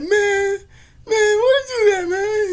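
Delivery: very high, loud, drawn-out mock wail; whiny mock crying. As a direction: imitate a victim wailing over stolen food. Victim whining over eaten burger.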